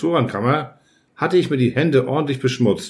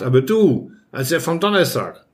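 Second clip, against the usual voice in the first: ordinary delivery, nothing marked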